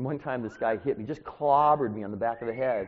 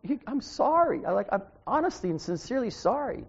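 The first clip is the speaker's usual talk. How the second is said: sincere apology; 9 semitones higher than usual. Retold or imitated. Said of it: "I'm like".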